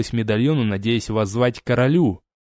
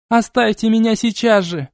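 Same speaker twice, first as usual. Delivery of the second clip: high, loud, desperate cry; struggling against restraints; 11 semitones higher, 4 dB louder than usual. Acted. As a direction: fight free while yelling. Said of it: "Айлани пыталась вырваться".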